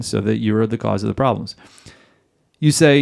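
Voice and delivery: ordinary talk, nothing marked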